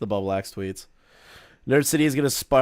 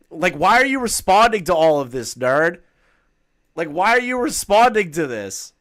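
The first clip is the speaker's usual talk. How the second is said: loud, high, exasperated outburst; exasperated yell; 8 semitones higher, 5 dB louder than usual. Real, not acted. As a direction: yell it in disbelief at the screen. Exasperated at commenter responding.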